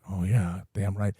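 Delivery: low, murmured mock approval; mock impressed agreement. Listener swallowing the mafia wisdom, mock impressed. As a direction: agree softly, deep in pitch, as if awed. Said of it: "you're like".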